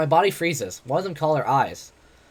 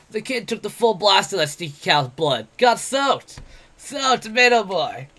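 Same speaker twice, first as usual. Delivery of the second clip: higher, louder mocking brawler voice; brash, jeering tavern boaster; cocky werewolf adventurer; 6 semitones higher, 5 dB louder, slower than usual. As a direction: taunt him like a swaggering bully. Bete voiced in the story.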